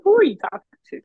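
high, loud, sassy; sassy challenge. Pretend. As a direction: put them in their place. Confronting the talking crow.

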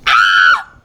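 short loud shriek of horror; shocked scream. Real, not acted. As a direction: scream at the sight. Shock at old photo.

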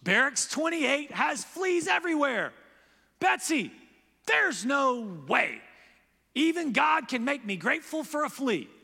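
high, loud exasperated complaint. Acted. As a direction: protest to her sister in despair. Corey's exasperated quoted outburst.

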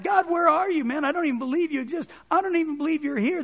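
high, fast, exasperated plea to God; imitated crying out. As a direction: act out a doubter calling on God. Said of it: "as he's crying out to God".

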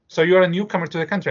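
eager grandmother, fast and interested; elderly woman. Retold or imitated. Old woman asking newcomer.

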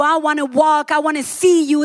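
ordinary delivery

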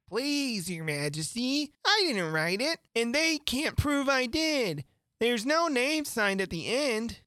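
high, drawn-out whine of protest; pleading knave. A narrator's reading. Knave denies writing the verses to the king, pleading. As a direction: plead innocence in a high, slow voice.

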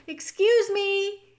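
high, slow polite little squeak; a tiny mouse trying to be heard; small mouse voice. Acted. The mouse politely tries again to reach the king, high and slow. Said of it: "he said".

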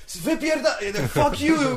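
high-pitched mock fury; angry outburst. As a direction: imitate an enraged heckler cursing someone out. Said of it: "He got so angry".

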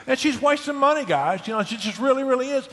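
high-pitched mock indignation, pitch shooting up; hammed-up complaint about the wife wasting money; mock outrage. Husband mock-complaining to the guys about toothpaste, high and indignant.

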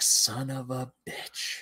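slow, drawn-out curse; stunned realization. Retold or imitated. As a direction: stretch it out as the answer dawns. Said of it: "I was like".

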